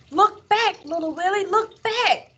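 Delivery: high-pitched, excited urging; urgent warning cry. A narrator's reading. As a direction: call out to warn the racer.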